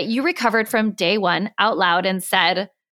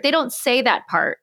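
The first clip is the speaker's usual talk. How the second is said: ordinary delivery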